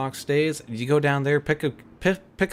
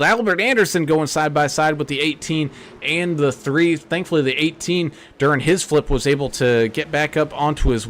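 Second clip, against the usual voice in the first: play-by-play commentary; 5 dB louder than usual. Acted. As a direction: lively race commentator voice. Live sim race play-by-play.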